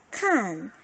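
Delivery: "칸" with a falling Mandarin fourth tone. Shown as a demonstration. Teacher models the syllable for students, "칸" in falling fourth tone. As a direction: drop the pitch firmly through "칸". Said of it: "사 성이니까".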